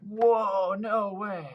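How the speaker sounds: high, loud "whoa, no way"; a boy's shocked delight at the strike; astonished. Retold or imitated. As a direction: gasp it out as the fish hits. A huge fish strikes.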